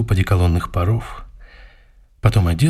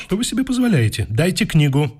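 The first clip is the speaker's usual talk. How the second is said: indignant outburst; 8 semitones higher than usual. Acted. Client demands complaint book, indignant.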